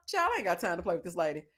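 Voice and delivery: normal talking voice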